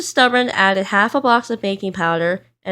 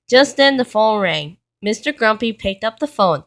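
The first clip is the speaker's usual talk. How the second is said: usual speaking voice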